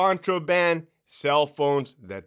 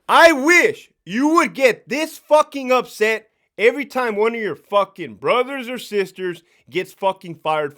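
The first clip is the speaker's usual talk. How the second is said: higher, louder furious rant; angry outburst; 5 semitones higher, 7 dB louder than usual. Real, not acted. Ex-officer scolding colleagues for caring about tablets, angry. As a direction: let the anger burst out.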